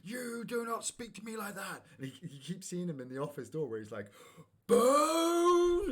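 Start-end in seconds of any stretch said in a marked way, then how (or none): 0.0-1.8 high, drawn-out outraged officer impression; mock-indignant rant, slow and high; going off on one. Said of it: "Captain Holt goes off on one". 4.6-5.9 long, high, loud imitated yell; hammed-up outraged shout, stretched out; over-the-top outburst. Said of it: "he's completely over the top of it".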